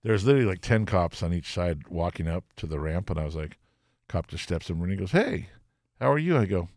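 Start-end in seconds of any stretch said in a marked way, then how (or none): 5.1-6.4 mock friendly cop. Cop greets him sweetly.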